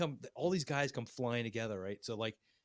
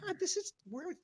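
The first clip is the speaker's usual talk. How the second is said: mock panic; 13 semitones higher than usual.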